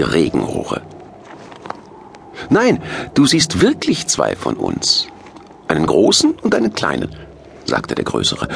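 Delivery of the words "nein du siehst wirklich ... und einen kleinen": high-voiced Santa, emphatic; taller Santa character. A narrator's reading. Taller Santa answers Rufus in character.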